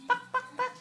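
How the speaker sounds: high-pitched mock hen clucks; squeaky imitation of a hen; chicken clucking.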